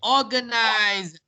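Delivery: organize drawn out slowly with a voiced z ending; clear Z sound. Shown as a demonstration. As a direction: say organize very slowly, holding the final z. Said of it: "is pronounced with a Z sound".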